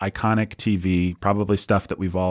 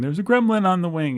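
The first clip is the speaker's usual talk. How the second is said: mock alarm; 10 semitones higher than usual. Quotes panicked catchphrase from TV episode.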